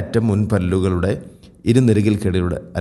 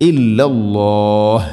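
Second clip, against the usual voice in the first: ഇല്ലല്ലാഹ് chanted with the last aa held long; elongated stop; 4 dB louder, much slower than usual. Shown as a demonstration. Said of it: "ഖദറിൽ നീട്ടി നിർത്താവുന്ന മദ്".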